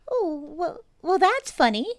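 high, loud, squeaky little pig; Piglet voice. Acted. Piglet after falling, high squeaky voice. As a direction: squeak it out like a tiny nervous pig. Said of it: "he thought".

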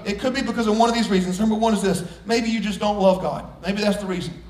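ordinary talk, nothing marked

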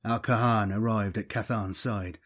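ordinary delivery, nothing marked

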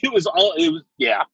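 usual speaking voice

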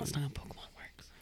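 hushed whispered correction; breathy aside under the breath. Real, not acted.

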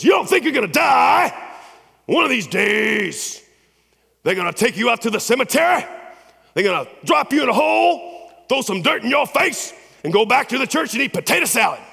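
high, loud preaching shout with a laugh at the end; impersonating a fiery preacher. Retold or imitated. Pastor telling graduates about their own burial, shouted preaching. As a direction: hammer the graduates with the graveside picture. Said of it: "He goes".

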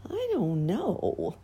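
slow, hesitant and unsure; doubtful. A narrator's reading. Clown answering the sour woman, slow and unsure. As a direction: drag it out in doubt. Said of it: "replied the little clown doubtfully".

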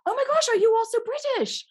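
very high, loud British exclamation; British accent. Retold or imitated. British director questioning the actor's accent, British accent. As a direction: imitate a delighted British director. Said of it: "I heard your British accent".

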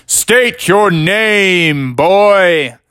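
booming, drawn-out guardian's challenge; scary voice. Acted. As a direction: call out loud and very slow to frighten an intruder. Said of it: "your voice is pretty scary".

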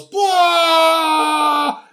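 high shouted blah cry; mock battle yell. Host voicing the hero yelling as he jumps the ramp. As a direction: let out a cartoon war cry.

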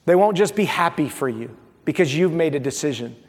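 ordinary delivery

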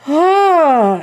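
big, loud, falling sigh of disbelief; loud exasperated sigh. Real, not acted. Reacting to Rochester's cruelty, with a loud exasperated sigh.

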